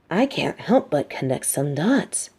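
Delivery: normal talking voice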